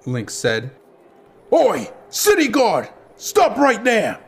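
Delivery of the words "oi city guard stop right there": high, forceful guard's call; booming shout. A narrator's reading. City guard orders street gang to halt, booming. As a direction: call out to halt fleeing thieves. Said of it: "a voice boomed".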